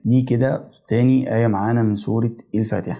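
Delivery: normal talking voice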